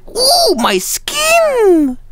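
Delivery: drawn-out, very high falsetto wail; hammed-up yelp, stretching the words slowly. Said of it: "it just seems like he would say".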